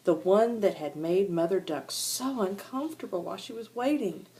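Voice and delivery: ordinary delivery, nothing marked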